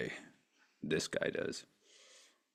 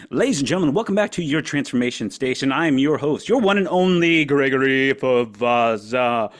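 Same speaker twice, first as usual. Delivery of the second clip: loud, showy host intro; show host announcer; 5 semitones higher, 11 dB louder than usual. Acted. Opening his stream to viewers, booming host announcer.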